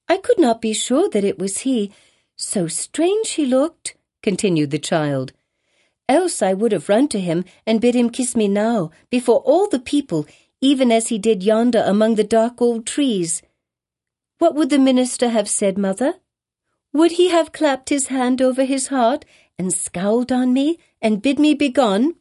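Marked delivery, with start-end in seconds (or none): 0.1-4.0 put-on child voice. Pearl's child voice. 6.1-22.2 put-on higher, eager little girl; child voice. Pearl keeps asking.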